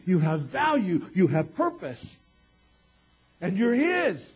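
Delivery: building from measured to loud, high proclamation; preaching. Real, not acted. Preacher urging the congregation about freedom from the past, building preaching. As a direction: preach it, rising to a full-voiced climax.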